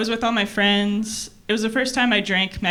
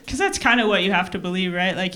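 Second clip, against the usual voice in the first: ordinary talk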